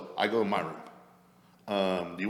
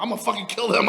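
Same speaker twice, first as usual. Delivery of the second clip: loud, higher-pitched drunken threat, retold; acted fury at a raised pitch; pissed off; 11 semitones higher, 5 dB louder than usual. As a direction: re-enact an angry outburst, raised and loud. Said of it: "being so drunk and pissed off".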